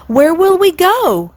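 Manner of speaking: high, slow eager question; excited cry. A narrator's reading. Cygnets eagerly asking their father, high and slow. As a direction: cygnets crying out, thrilled. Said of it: "cried all the cygnets".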